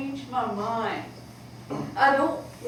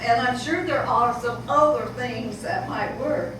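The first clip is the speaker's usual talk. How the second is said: ordinary talk, nothing marked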